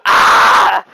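loud imitated scream of panicking kids; mock kid's scream. Showing friends the kids' breakdown, mock scream. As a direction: scream like a child in meltdown. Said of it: "why are they screaming?".

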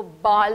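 बाल loud with long open "aa" for ball; wrong pronunciation. Shown as a demonstration. Teacher quoting people's mistake, wrong pronunciation. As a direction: stretch an open "aa" in बाल, no rounding. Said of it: "that is a wrong pronunciation".

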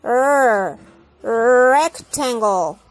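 rectangle slowly, the R with the tongue pulled back; English R. Shown as a demonstration. Teacher modelling the English R for the class. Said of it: "con la lengua hacia atrás".

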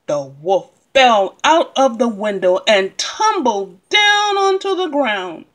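ordinary delivery, nothing marked

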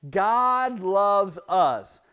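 very slow and loud, every word drawn out; slow group recitation. Shown as a demonstration. Leading children to repeat after him, slow and loud. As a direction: chant it out with the kids, word by word. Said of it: "Can you say that with me".

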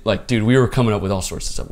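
usual speaking voice

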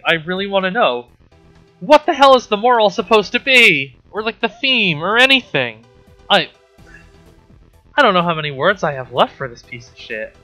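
ordinary talk

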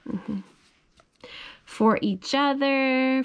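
plain reading voice

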